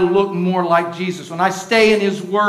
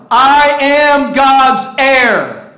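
shouted slowly, much higher and louder; slow declaration; 7 semitones higher, 9 dB louder, much slower than usual. Shown as a demonstration. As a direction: proclaim each word. Leading congregation, slow shout.

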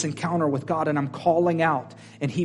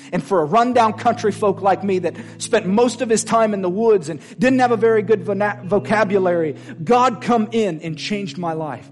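preaching at full force; 5 semitones higher, 4 dB louder than usual. Acted. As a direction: drive each line home to the congregation. Preaching climax.

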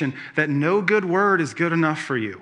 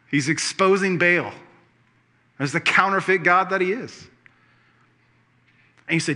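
ordinary delivery

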